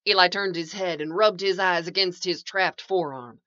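ordinary delivery

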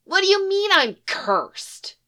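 put-on high kid voice, indignant; an eleven-year-old demanding answers; high boy's voice pushing back, offended. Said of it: "he demands".

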